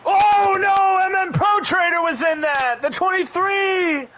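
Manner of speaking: high, loud alarmed shouting; shocked shout. Real, not acted. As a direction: react to the crash live. Crash reaction on broadcast.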